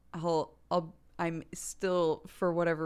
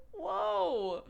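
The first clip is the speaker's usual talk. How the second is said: much higher, surprised whoa; startled whoa. Retold or imitated. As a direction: act taken aback. Mother's retold surprise at outburst.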